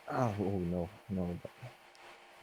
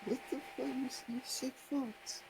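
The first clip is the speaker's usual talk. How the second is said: put-on girly voice; 4 semitones higher, 11 dB quieter than usual. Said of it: "Why are you talking like this?".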